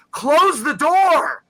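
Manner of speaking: high, slow, loud drawn-out yell; yelling at the screen. Retold or imitated. Host shows what he nearly yelled in the theater, shouting. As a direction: bellow at the dumb characters to shut it. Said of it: "I almost yelled".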